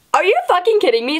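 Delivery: fast, high incredulous snap; retold outrage. To a friend confessing love at the worst time, high and fast. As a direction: fire it back in disbelief. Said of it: "even more mad at this point".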